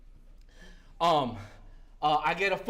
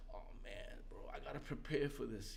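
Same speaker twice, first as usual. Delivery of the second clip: inner whisper; 11 semitones lower, 20 dB quieter, faster than usual. Retold or imitated. Inner thought, soul drops.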